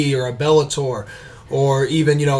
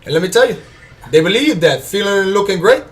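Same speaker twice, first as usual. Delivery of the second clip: higher, hammed-up Cuban gangster accent; Tony Montana accent; 4 semitones higher than usual. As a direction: brag about the brothers like a Cuban gangster, in a mock Cuban accent. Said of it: "Dave suggested Tony Montana".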